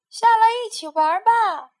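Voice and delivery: loud, high call from below. A narrator's reading. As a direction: shout up to the tree. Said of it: "朋友们喊道". (朋友们大声往上喊)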